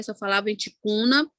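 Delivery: ordinary talk, nothing marked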